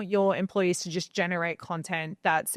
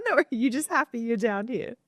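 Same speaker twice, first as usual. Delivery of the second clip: amused laughter; 6 semitones higher, 3 dB louder than usual. Real, not acted. Teasing the dog on the podcast.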